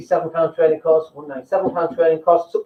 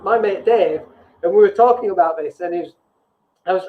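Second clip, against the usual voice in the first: usual speaking voice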